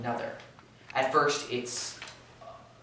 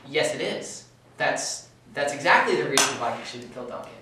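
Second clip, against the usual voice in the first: louder, insistent contradiction; emphatic rebuttal; 3 semitones higher, 7 dB louder than usual. Real, not acted. Student contradicting a classmate in a Macbeth debate, insistent. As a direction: push back hard, leaning on "exactly".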